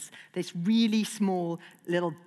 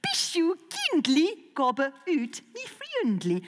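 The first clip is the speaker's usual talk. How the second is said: dragon language; 7 semitones higher than usual. Acted. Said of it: "common dragon phrases".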